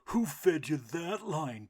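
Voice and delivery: loud, slow bully duck voice; bully squawk. A narrator's reading. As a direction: block the path and sneer. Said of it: "Drake chuckled".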